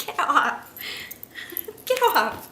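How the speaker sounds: real laughter breaking into the words; laughing protest. Real, not acted. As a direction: giggle while shooing off a pesky dog. Dog climbing on her while filming.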